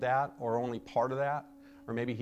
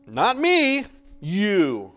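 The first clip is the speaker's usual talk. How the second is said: mock-defensive shout; defensive outburst; 3 semitones higher, 11 dB louder, much slower than usual. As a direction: throw the blame back hard. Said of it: "we get defensive".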